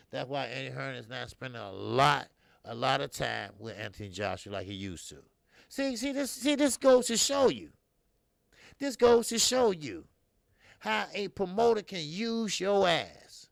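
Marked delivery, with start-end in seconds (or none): 5.7-13.5 much higher and louder, pouncing; fired-up told-you-so; excited realization. Real, not acted. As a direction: jump on the point like proof. Seizing on his point.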